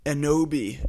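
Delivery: loud, high announcement; triumphant reveal. A narrator's reading. Dumbledore revealing the chosen student, triumphant. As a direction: proclaim the chosen one.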